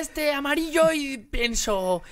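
mock Mexican accent. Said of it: "mock Mexican-accent voice".

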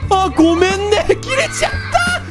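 put-on high cute anime girl. Cooing an apology to 空猫.

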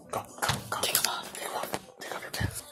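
whispered urging; whispering. Real, not acted. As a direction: whisper it urgently.

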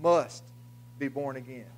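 emphatic "must", held off by a pause; stress on "must". Shown as a demonstration. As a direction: lean on "must", pause, let the rest drop. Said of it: "with the emphasis on there, must".

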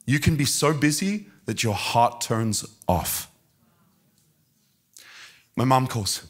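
ordinary delivery

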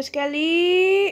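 stretched, loud excited call; excited drawn-out call. Real, not acted. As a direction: drag the word out with glee.

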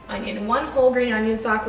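ordinary delivery

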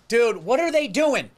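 mildly worked-up complaint; mock sports-fan frustration; acted flipping out. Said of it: "Start flipping out".

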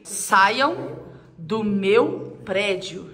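very slow eerie reading; slow spooky reading. Acted. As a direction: draw out each word like a threat. Reading the sign slowly, spooky.

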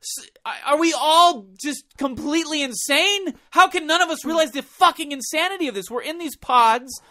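high, loud incredulous rant; stoned existential rant. Retold or imitated. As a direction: rave about the madness, baked. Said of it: "Yeah, like, what?".

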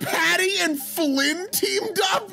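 shocked shout. Real, not acted. Shocked at game twist.

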